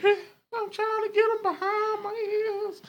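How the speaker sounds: high, slow, strained squeak; mock straining woman. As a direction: drag it out in a high put-on voice. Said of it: "and she like".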